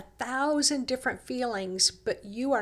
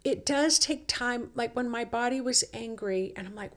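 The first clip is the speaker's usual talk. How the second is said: ordinary delivery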